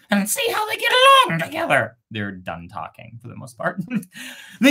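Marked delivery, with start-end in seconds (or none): 0.1-2.0 very high, fast bird voice; first bird. Acted. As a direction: chatter quickly like a bird. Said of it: "said the first bird".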